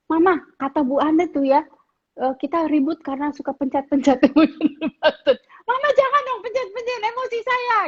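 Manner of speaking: hammed-up child protest, climbing to a high whine; whiny complaining kid. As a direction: ham up a kid blaming mum. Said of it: "Kamu nggak bisa bilang gini".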